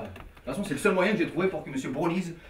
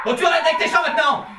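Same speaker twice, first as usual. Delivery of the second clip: loud, high scolding; exasperated shout; 9 semitones higher, 8 dB louder than usual. Acted. Man ordering his partner to stop singing, exasperated shout. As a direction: shout him into stopping.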